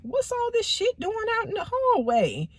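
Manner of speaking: mother finds clothes in the hallway, high and puzzled; high mom voice. Retold or imitated. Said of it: "and she like".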